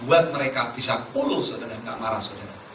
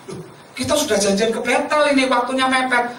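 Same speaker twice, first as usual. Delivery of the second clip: much higher-pitched, imitated objection; retold protest; 9 semitones higher than usual. Telling the caller there is no time to stop, protesting. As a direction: act out a startled, high-pitched protest.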